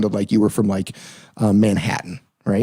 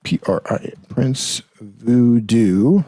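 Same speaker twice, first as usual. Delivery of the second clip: "Prince Voodoo" very slow, each syllable stretched; "Prince Voodoo" dragged out, left hanging on a rise; sounding out slowly; much slower than usual. Real, not acted. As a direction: draw out "Prince Voodoo" as if typing it. Sounds out name while typing it.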